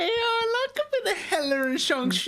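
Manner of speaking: very high, silly falsetto delight; hammed-up high-pitched approval; gleeful squeal. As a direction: squeal with comic eagerness. Playful squealed approval of the pairing.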